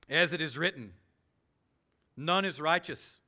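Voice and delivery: preaching a Psalm with force; emphatic scripture reading. Acted. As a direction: proclaim each word of the verse.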